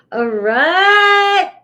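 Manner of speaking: excited. Real, not acted. Laughing, silly loud reset.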